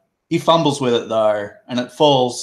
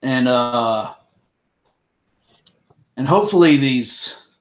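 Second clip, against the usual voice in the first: louder, firm lawman call; 3 semitones higher, 5 dB louder than usual. Acted. Russet to the others, a touch louder and commanding. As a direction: take charge like a sheriff.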